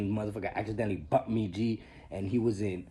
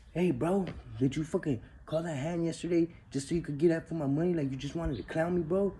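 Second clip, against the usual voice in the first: higher, hammed-up accusing whine; accusing imitation; 8 semitones higher than usual. A cellmate confronts him over coffee, mock accusing whine. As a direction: play the wounded loser picking a fight.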